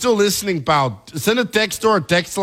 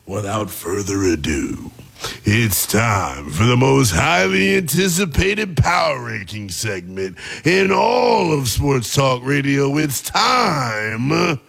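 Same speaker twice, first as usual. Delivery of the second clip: slow booming segment intro; announcer voice; 5 semitones lower, slower than usual. Acted. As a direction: drag it out like a hype man.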